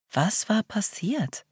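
slow, soft whispered question. Acted. Narrator alone with her fear, slow whisper. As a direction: breathe the question under your breath. Narrator's anxious inner thought.

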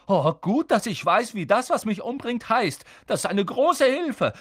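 high, loud sarcastic snap; boy snapping at the girl while trapped; snapping sarcastically. Acted. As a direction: bite back with scorn. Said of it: "fauchte Ron".

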